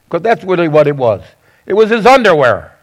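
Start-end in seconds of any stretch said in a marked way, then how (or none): none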